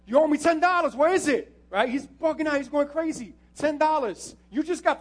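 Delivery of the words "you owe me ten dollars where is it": loud, higher shouted demand for money; angry demand. Retold or imitated. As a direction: yell at him for the ten dollars. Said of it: "He's upset".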